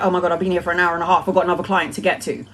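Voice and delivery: fast, hurried mock panic; blurting it out in a rush; rushed realisation. Reader realising she has overstayed with a client, fast and hurried. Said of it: "And then I'm like".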